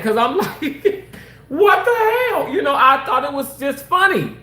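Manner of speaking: real laughter through the words; can't stop cracking up; laughing. Real, not acted. Telling viewers a funny memory, laughing hard. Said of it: "I crack up laughing again".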